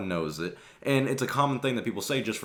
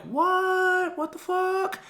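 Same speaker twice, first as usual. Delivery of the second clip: squeaky, much higher mock disbelief; mock shocked crowd; much slower than usual. Whole country hearing the truth, shrill mock shock. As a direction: play the stunned public in a silly high voice. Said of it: "they're all like".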